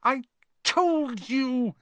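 very drawn-out, high, breathless reproach; winded and high-pitched; gasped. A narrator's reading. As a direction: gasp it slowly, clutching a stitch. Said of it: "Hermione gasped".

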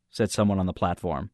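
usual speaking voice

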